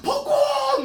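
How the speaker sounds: loud, very high falsetto squeal; exaggerated shriek of delight; excited women passengers. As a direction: mimic women going wild over blossoms. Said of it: "막 이러면서 막 난리가 나요".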